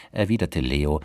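ordinary delivery, nothing marked

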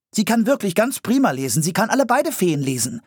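put-on high-pitched boy talking someone up; high, urgent recommendation; eager boy. A narrator's reading. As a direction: enthusiastically sell the idea in a child's voice. Rief Philipp sofort.

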